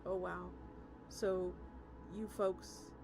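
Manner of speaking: usual speaking voice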